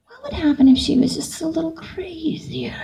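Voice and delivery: hushed, scheming stage whisper; conspiratorial. Retold or imitated. Actor plotting to push her character further, conspiratorial. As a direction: float the idea in a sly whisper.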